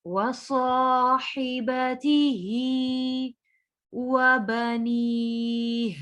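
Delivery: slow, melodic tajweed chanting; Quran recitation. Shown as a demonstration. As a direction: draw the vowels out in a slow recitation.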